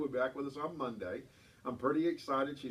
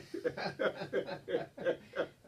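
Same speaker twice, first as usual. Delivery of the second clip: long laugh; 4 semitones higher than usual. Real, not acted. Laughs after punchline.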